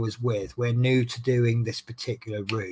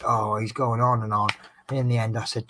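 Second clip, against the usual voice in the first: ordinary delivery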